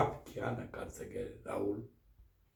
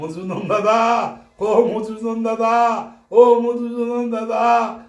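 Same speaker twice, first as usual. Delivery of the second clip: calling out louder and higher, slowing at the end; anguished calling; 5 semitones higher, 5 dB louder than usual. Acted. Boy calling to Madhusudan in the forest, anguished louder calls. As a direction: cry out across the forest in distress. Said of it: "कातोर प्राणे पुकार रहा है".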